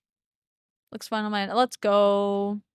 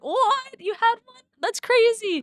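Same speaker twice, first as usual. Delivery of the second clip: high, amazed outburst from the shop staff; surprised; 12 semitones higher, slower than usual. Retold or imitated. Shop staff amazed that he brought one, much higher surprised voice. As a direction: play it like someone who can't believe what they just heard.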